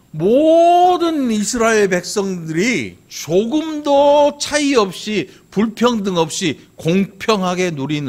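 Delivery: high, loud and slow proclamation; impassioned sermon emphasis; full-force preaching. Real, not acted. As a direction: stretch the key words out long and loud.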